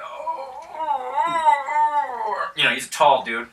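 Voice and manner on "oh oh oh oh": high, wailing moans; a wounded peasant groaning in pain; hammed-up wail; moaning peasant. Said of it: "he's like".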